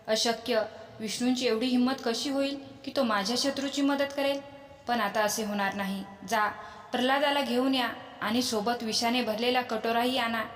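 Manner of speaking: usual speaking voice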